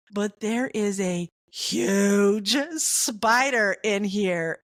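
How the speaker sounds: carefully breaking the news to his wife; slow careful warning. Retold or imitated. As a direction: spell it out slowly so it lands. I don't wanna alarm you.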